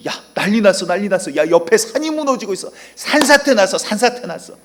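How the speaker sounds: high, loud alarm as the mountain collapses; the ants yell to each other as their world falls apart, in high loud panic; panicked ant cry. Acted. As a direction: shriek the news like a terrified ant.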